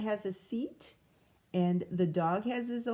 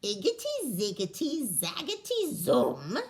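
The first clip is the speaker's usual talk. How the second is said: muttered spell; 2 semitones higher than usual. Acted. The witch stirring her cauldron, a swooping spell chant. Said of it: "she muttered a spell".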